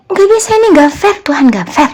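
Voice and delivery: retold indignant complaint, high and forceful; angry protest. Complaining to God over a business dispute, angry protest. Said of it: "Saya marah-marah sama Tuhan".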